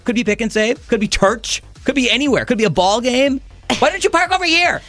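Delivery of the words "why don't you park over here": mock nagging wife. Husband voices wife's nagging.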